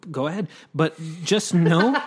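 laughing loudly through the words. Real, not acted. Host laughing while teasing co-host about his Australian voice. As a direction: laugh while you tease your friend.

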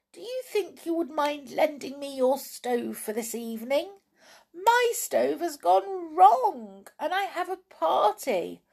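high, tiny fairy voice calling out; tiny elf voice. Acted. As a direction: call like a tiny elf from behind a flower. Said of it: "she heard a tiny voice calling to her".

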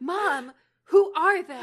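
overacted, half-laughing drama; overdramatic. Shown as a demonstration. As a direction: make it hugely theatrical. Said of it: "or just over dramatic".

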